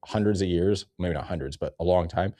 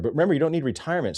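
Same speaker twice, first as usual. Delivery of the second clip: ordinary delivery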